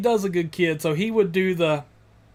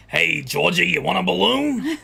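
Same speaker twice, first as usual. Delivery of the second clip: put-on creepy clown luring a child; lightly put-on sinister clown voice; Pennywise. Demonstrating the prank call as the clown, lightly creepy. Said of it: "I would do the".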